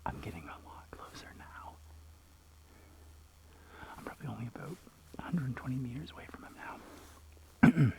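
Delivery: hushed whisper, sneaking up on wildlife. Real, not acted. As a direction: whisper so the bird stays put. Sneaking close to feeding eagle.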